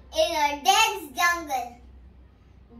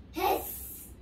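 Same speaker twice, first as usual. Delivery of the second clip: snake hiss; 7 dB quieter than usual. Acted. Snake hissing in story.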